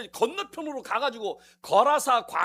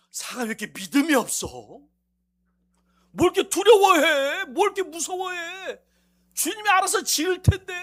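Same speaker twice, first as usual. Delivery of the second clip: teasing scold; 6 semitones higher, 9 dB louder than usual. Acted. Pastor mocks worried members.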